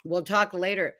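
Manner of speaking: ordinary talk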